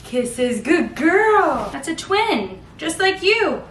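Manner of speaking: high, sing-song cooing at the babies. Real, not acted. Mother cooing to her baby girls about kisses, high sing-song.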